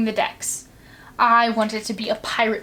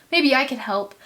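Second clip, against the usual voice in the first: ordinary delivery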